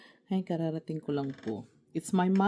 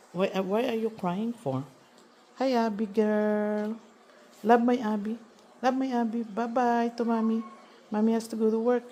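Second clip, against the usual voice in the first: higher, sing-song voice for the dog; pet talk; 5 semitones higher than usual. Real, not acted. Owner says goodbye to her dog Abby, high sing-song coo.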